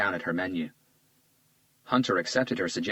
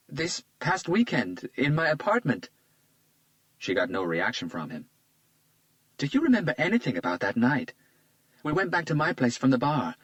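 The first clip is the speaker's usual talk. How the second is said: put-on lightly raised, charmed young woman; a flattered young woman, a bit higher; Isabella's voice; 7 semitones higher than usual. A narrator's reading. Isabella charmed by Hunter's compliment, lightly higher voice.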